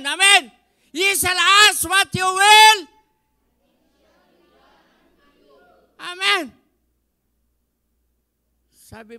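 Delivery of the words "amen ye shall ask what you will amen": very loud, high shouting preacher; preaching at full force. Acted. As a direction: cry it out to the church. Preaching peak.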